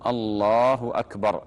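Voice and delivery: slow, full "الله أكبر"; demonstrated takbir. Shown as a demonstration. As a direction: call "الله أكبر" as if opening prayer. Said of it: "ثم يقول".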